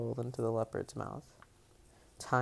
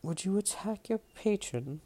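higher-pitched attempted woman's voice; chiding woman; 7 semitones higher than usual. A narrator's reading. Hooded woman to Jason with sword drawn, chiding. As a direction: put on a lady's voice, scolding. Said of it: "the woman chided".

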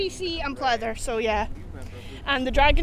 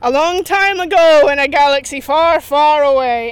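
louder, grand movie opening line; dramatic quote; 7 dB louder than usual. Pretend. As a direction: boom it like the film intro. Quoting Star Wars opening crawl.

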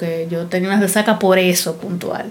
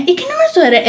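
pestering lady voice; 8 semitones higher, faster than usual. Retold or imitated. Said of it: "venía".

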